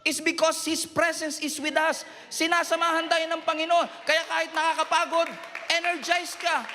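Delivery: rising fervent shouts of encouragement; preaching at full force. Acted. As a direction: preach it hard, lifting the room. Preacher builds to climax.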